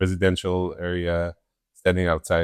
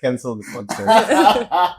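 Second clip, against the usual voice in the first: laughing; 10 semitones higher, 4 dB louder, slower than usual. Real, not acted. Mock outrage after losing quiz.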